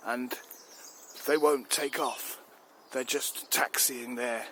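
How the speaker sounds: loud, fuming military complaint, slowing on the key lines; angry rant. Acted. Chief rants at the minister about his pilots, fuming. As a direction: rage at the pilots who won't take off. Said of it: "I'm angry".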